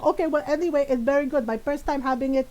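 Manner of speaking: put-on Filipino-accented mom brushing it off; mom's Filipino accent. Mom praising the gelato, in her Filipino accent. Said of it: "in my mom's voice".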